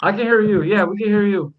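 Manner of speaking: loud, high call down the line. Real, not acted. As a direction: call out so the caller hears. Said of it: "Can you guys hear me?".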